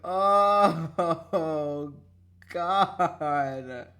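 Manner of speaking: usual speaking voice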